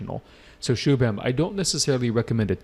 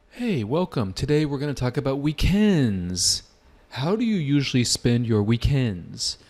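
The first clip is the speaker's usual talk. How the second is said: higher, welcoming examiner opening; 4 semitones higher than usual. Shown as a demonstration. Teacher demonstrating the examiner opening the weekends topic. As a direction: greet the candidate like a friendly examiner. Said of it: "I'll give you an example".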